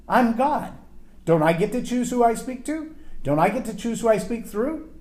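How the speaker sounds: indignant God. Retold or imitated.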